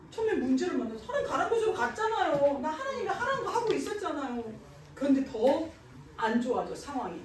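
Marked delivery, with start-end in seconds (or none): 0.1-4.5 high-pitched pleading complaint; acted aggrieved protest; mock protest. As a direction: plead your case in a higher voice. Voices a believer protesting to God.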